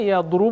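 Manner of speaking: یضرب with u on the middle; یضرب spoken yad-rub with rounded u; یضرب as yadrub, second trial. Shown as a demonstration. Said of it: "اب مجھے بتائیے آپ کہ یضرب بولنا آسان ہے".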